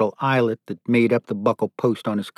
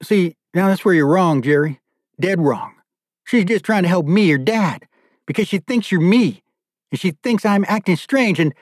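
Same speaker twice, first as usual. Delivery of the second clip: higher-pitched, emphatic correction; arguing back; 8 semitones higher than usual. Acted. Father telling captor he is dead wrong, insistent. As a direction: press the point urgently until cut off.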